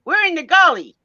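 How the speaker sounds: imitating a friend's Southern "We're in the gully"; Southern accent. Friend on a bad phone line, Southern accent. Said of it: "But she said it like this".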